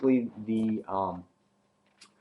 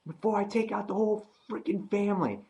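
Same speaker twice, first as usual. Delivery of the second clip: much higher, menacing threat; threatening rant; 10 semitones higher than usual. Retold or imitated. Nicholas threatening Glenn's family, much higher. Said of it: "He's like".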